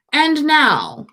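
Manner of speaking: plain reading voice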